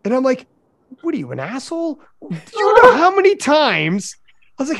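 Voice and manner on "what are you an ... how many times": high-pitched mock outrage; retold telling-off, rising and getting louder; incredulous scolding. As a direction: scold them in exaggerated disbelief. Acted scolding of the coworker.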